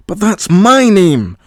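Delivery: loud, high, slow angry shout; furious shout at the computer. A narrator's reading. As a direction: yell it in outrage. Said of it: "shouted Paul".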